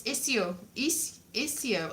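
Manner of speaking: isio with a clear "s"; isio as "iss-yo". Shown as a demonstration. Learner correcting Duolingo, isio with plain "s". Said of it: "I know it's isio".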